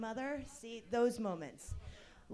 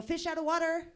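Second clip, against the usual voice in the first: higher, louder mock call; 8 semitones higher, 5 dB louder than usual. Mom calling out in the store, louder. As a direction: shout it out like the game. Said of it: "I go".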